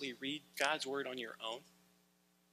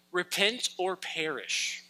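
stretched, louder street-preacher call; mock street-preacher cry; 4 semitones higher, 5 dB louder, slower than usual. Street preacher to the passing crowd, mock bullhorn cry. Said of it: "screaming at the world".

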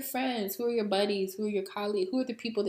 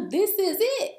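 panicked laughing; 7 semitones higher, 5 dB louder than usual. Retold or imitated. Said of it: "I thought".

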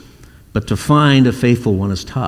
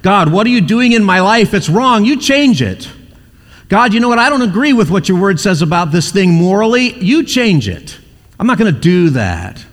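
demanding complaint; 7 semitones higher than usual. Retold or imitated. Stubborn person demanding God change.

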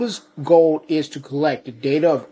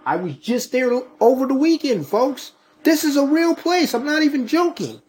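pitched-up, urgent disbelief; high excited insistence; 11 semitones higher than usual. Real, not acted. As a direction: insist it's real, voice rising high. Insisting he saw it.

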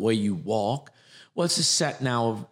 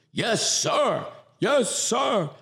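higher, eager yes sir; recruit answering; 11 semitones higher, much slower than usual. Retold or imitated. A recruit answering the drill instructor. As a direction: snap back to the drill instructor. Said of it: "you hear the drill instructor and he, you go".

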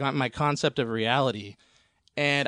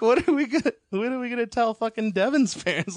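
high, louder mock panic; freaking out; 8 semitones higher, 3 dB louder than usual. Tripping friend asking Mike about Devin's parents, mock freak-out. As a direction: replay the panic with the voice jumping up high. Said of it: "I just start freaking out".

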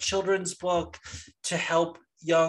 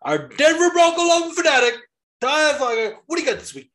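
booming high hype-man announcer; Rhino's show intro; 12 semitones higher, 6 dB louder than usual. Retold or imitated. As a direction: holler it like a ring announcer, high and loud. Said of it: "he's like".

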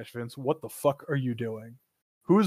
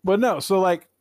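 normal talking voice

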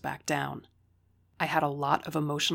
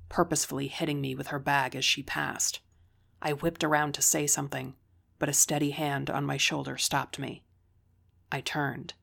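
ordinary delivery

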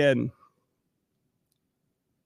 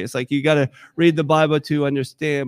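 ordinary talk, nothing marked

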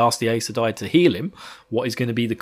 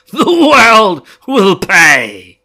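higher, much louder, very slow crazed shout; mad shout; 6 semitones higher, 12 dB louder, much slower than usual. Acted. Fane screams his threat at the night, crazed shout. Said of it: "He crackled at the night, mad with laughter".

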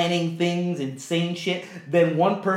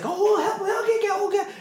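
high, squeaky frantic voice; mock panicky kid; much faster than usual. Mocking panicked kids.

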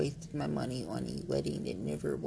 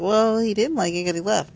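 imitated challenge; 5 semitones higher, 10 dB louder than usual. Quoting people's snide challenge.

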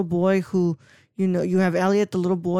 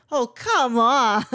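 slow, higher exasperated "oh come on"; hungover groan; 5 semitones higher, 4 dB louder, much slower than usual. Retold or imitated. Elliot groaning at E.T. dressed as a girl, slow and put-upon. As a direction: drag it out like a hungover kid. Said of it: "and he goes".

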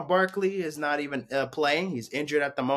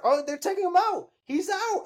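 real surprise; 12 semitones higher than usual. Real, not acted. Fan watching Spencer Strider get taken out, surprised. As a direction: pitch leaping up in live surprise. Pitcher pulled from game live.

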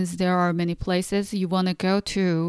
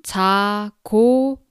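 slow model phrase. Shown as a demonstration. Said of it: "slowly three times".

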